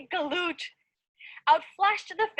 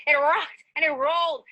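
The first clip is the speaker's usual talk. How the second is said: plain reading voice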